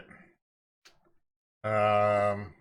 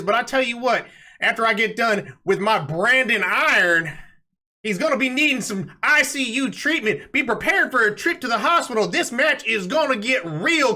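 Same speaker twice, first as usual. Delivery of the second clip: cowboy heel cutting a promo; brash Florida cowboy wrestler; wrestling promo. Acted. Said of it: "Take it away, [name]".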